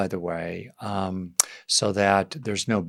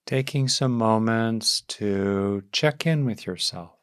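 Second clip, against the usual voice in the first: guided meditation. Real, not acted. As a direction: ease them gently into settling, leaving space between phrases.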